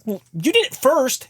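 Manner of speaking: put-on high, slow kid voice; child voice. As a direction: sass back like a small kid. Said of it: "the kids say to me".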